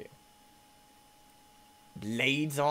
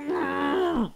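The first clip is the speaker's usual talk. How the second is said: long high shout; real panic; screaming gasp and no; 6 dB louder than usual. Real, not acted. Player panicking at a crash, high scream.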